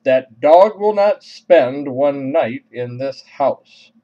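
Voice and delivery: loud, slow, emphatic scolding; stern admonishment. Retold or imitated. Mother at the side door banning the dog, stern admonishment. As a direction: lay down the law word by word. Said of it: "with the admonishment of".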